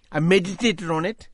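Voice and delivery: ordinary delivery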